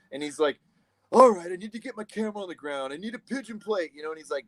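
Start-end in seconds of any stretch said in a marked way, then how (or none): 1.1-3.9 high, exasperated imitation of a crewman; retold irritated grumbling; mad camera operator. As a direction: grudgingly bark orders, pitched up. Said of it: "And he's all mad".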